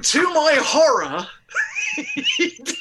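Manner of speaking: high, loud, laughing through the words; cracking up mid-sentence. Real, not acted. Telling the host of the heckler, laughing hard. Laughing while recounting horror.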